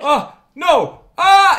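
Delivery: very high, loud panicked scream; terrified screaming. Acted. Captive learns he will be eaten, terrified screaming. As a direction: scream out in alarm. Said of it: "he starts screaming".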